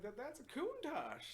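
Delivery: high, slow awed voice; impressed car guy. Retold or imitated. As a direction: say the name like it's holy. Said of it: "He was like".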